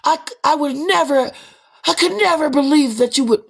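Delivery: high, hammed-up gasp of offense; mock shocked outrage. Acquaintances shocked he did what he said, high mock outrage. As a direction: clutch pearls in exaggerated high disbelief. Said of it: "they be shocked".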